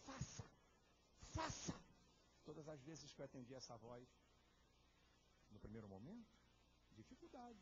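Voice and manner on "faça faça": higher and louder each time; building command. Acted. God's inner voice pressing him, building command. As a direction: push the order harder. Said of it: "lá no fundo, dizendo assim".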